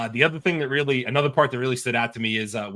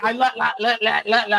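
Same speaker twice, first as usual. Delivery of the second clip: high, stuttering glitch on one syllable; imitated glitching; 10 semitones higher, 3 dB louder than usual. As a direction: stutter like a malfunctioning AI. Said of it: "like, glitching out".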